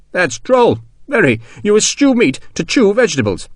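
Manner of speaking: high-pitched, delighted teasing; tickled general, voice lifted well up; amused. A narrator's reading. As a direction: savour your own wordplay. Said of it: "decided this was a splendid joke".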